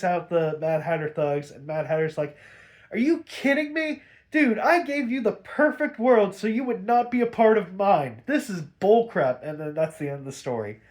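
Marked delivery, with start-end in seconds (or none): 2.9-9.4 high-pitched, exasperated mock outburst; indignant complaint. Mad Hatter after Bruce wrecks his plan, indignant complaint. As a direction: act out a thwarted villain's indignation.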